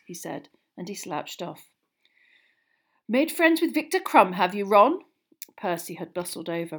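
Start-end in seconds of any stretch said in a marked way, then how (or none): 3.1-5.0 high, loud pompous enquiry; pompous brother. A narrator's reading. Older brother approving boy's famous new friend, pompous. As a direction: swell with officious approval. Pompous Percy greets Ron.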